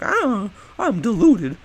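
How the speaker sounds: high, slow, loud whining voice; mock villain whine. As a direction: ham up a deluded villain. Cult leader messes with her.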